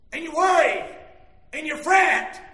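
loud, punched pulpit phrases; emphatic preaching. Real, not acted. Preacher describing the anxious worker, emphatic preaching. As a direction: hit worry and fret hard.

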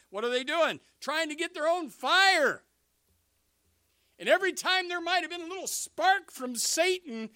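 loud, high-pitched pulpit proclamation; full-force preaching. Acted. Preacher deriding the false fire, full-force preaching. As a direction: hammer it out to the back row.

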